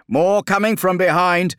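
high, loud warning call; urgent vox report. Acted. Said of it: "voxed Flavian".

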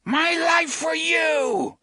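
very high, slow screamed vow; fanatical scream. A narrator's reading. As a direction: scream it with devotion. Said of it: "the man in the alcove screamed".

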